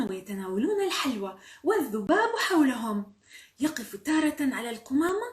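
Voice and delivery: animated, emphatic storyteller; children's story narration. A narrator's reading. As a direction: draw young listeners into the scene. Reads a children's story aloud.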